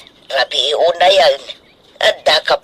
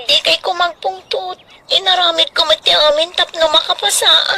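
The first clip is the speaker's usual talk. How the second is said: pleading upset; 10 semitones higher than usual. Acted. Daughter pleading after failing exam.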